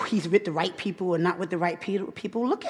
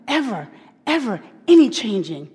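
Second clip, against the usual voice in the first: normal talking voice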